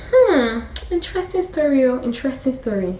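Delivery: high, musing mock interest; wondering listener. Listener pondering the Bible story, mock wondering. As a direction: muse it high, then let it settle. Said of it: "And now you're wondering".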